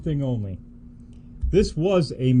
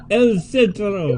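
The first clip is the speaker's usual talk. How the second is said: put-on Latin accent; 7 semitones higher, 5 dB louder, slower than usual. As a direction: draw it out slowly in a mock Latin accent. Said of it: "my Latin accent".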